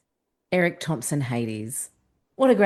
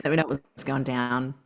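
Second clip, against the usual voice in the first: flirty call; 5 semitones lower, 4 dB quieter than usual. Retold or imitated. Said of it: "She would totally do that".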